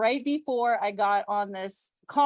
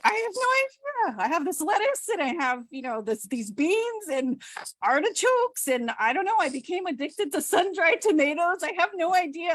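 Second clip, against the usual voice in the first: high squeaky bewildered; 9 semitones higher than usual. Retold or imitated. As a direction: voice jumps way up, sing-song. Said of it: "I'm like".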